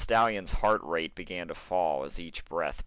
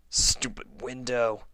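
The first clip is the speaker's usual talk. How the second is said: frustrated grumble; 5 semitones higher, slower than usual. Acted. Frustrated pegasus stuck at window.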